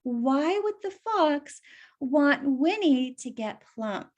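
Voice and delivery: ordinary delivery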